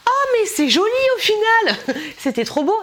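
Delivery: high, loud, gushing over it; delighted rediscovery. Retold or imitated. Finding a stored item again, loud delighted gush.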